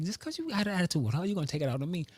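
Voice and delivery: high, fed-up rant at normal volume; retold yell. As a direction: re-enact an exasperated telling-off. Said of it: "and I yelled at her".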